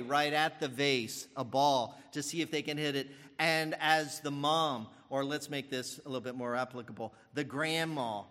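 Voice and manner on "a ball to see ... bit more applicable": usual speaking voice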